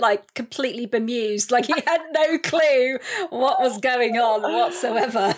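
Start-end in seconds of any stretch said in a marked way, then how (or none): 1.5-5.4 slow, laughing delivery. Real, not acted. Host laughing to guest about a bemused star. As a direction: chuckle through the line.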